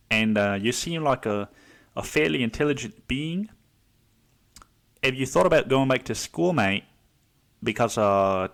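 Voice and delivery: Aussie bloke giving friendly advice; put-on Australian accent, questioning lilt. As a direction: talk like an Australian mate nudging someone. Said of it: "say it in a fucking Australian accent".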